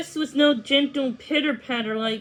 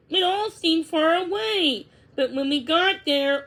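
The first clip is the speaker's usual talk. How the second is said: ordinary delivery, nothing marked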